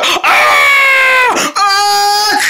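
high shouted scream; mock panicked scream. Acted crash panic.

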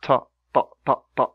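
"ta" with one heavy emphatic t burst; single thick t on "ta". Shown as a demonstration. Teacher drilling the emphatic ta for learners. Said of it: "One time".

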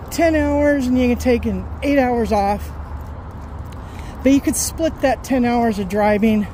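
ordinary talk

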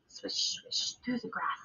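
soft whispered swish through the grass; whispered sound effect. Acted. Teacher reading to the class, whispering the badger's escape. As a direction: hush it like something slipping away.

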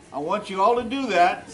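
ordinary delivery

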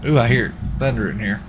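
usual speaking voice

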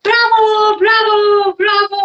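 high, loud cheering princess; cartoon princess. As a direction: squeal bravo like a delighted princess. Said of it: "said the princess".

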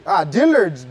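usual speaking voice

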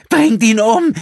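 very high, loud scream. Acted. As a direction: scream it out in hate. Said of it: "schrie Gina". (schriller, hasserfüllter Schrei)

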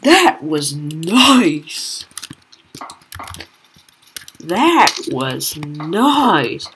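very slow, drawn-out exclamation with swooping pitch; amazed savouring. Real, not acted. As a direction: stretch each word in awe. Savours the great shot.

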